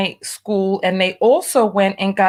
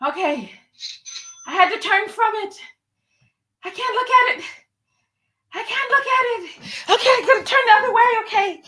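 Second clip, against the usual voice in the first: high, anxious, half-laughing squeak; nervous squeak; 11 semitones higher than usual. Real, not acted. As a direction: squeal it out, too nervous to look. Too nervous to look at result.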